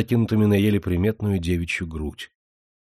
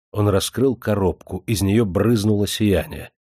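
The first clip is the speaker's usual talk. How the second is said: plain reading voice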